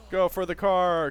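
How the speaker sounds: panicked call while fleeing; being chased. Real, not acted. Fleeing zombies in game.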